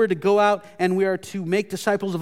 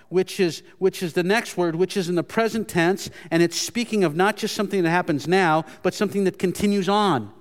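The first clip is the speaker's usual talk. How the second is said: normal talking voice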